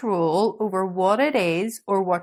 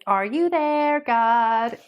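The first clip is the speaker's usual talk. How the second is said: Fiona's prayer; 6 semitones higher, slower than usual. Retold or imitated. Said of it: "where it's like".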